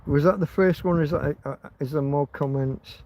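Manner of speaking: ordinary delivery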